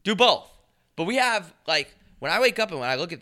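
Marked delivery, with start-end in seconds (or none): 0.0-0.5 louder, higher emphatic echo. Real, not acted. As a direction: punch it out loud. Echoing co-host loudly.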